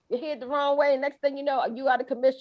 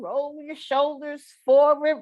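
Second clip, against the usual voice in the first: ordinary delivery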